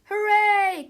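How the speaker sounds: loud, high, jubilant cry; one long gleeful whoop; children's cheer. A narrator's reading. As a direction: cheer like a class of kids. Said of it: "cried the children".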